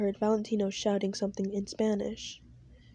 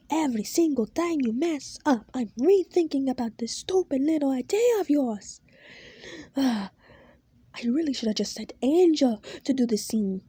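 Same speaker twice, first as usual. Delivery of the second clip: higher, louder furious rant; shouting boss; 4 semitones higher, 4 dB louder than usual. Acted. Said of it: "he says as he starts to shout".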